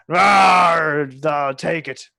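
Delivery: loud slow pirate bellow; booming pirate. Acted. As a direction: bellow it like a happy pirate captain. GM voices jovial pirate NPC.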